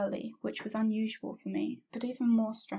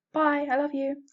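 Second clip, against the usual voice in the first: higher, louder yelled goodbye; 6 semitones higher, 7 dB louder than usual. A narrator's reading. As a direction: call out bye while skipping out. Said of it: "I yelled".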